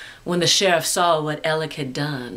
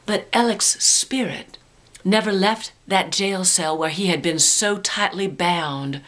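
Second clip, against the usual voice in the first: plain reading voice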